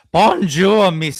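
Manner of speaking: loud, high hammed-up bonjour monsieur; imitating a French accent; mock French. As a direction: ham up a snooty French greeting. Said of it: "that would sound like".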